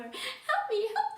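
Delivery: very high, loud squeal; bunny crying for help. Acted. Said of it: "the bunny cry".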